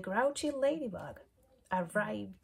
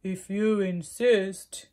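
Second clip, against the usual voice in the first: loud, slow, breathy rhino voice; rhinoceros voice; 11 dB louder, slower than usual. Acted. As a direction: huff it out like a rhino. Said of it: "said the rhinoceros".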